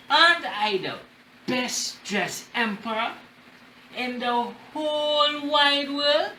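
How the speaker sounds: lightly voiced vain emperor, rising high on the last words; a touch of the preening king at his mirror; teacher voicing the vain emperor for the class; boastful emperor. Vain emperor admires himself.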